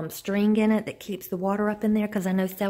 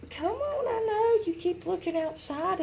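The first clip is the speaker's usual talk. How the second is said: high pet-talk voice; 8 semitones higher, 6 dB quieter than usual. Real, not acted.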